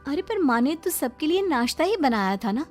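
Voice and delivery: usual speaking voice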